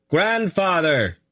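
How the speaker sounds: long, slow, high call. A narrator's reading. Boy calling again to an empty tower, slow high call. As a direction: draw the call out slowly and high. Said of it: "he called again".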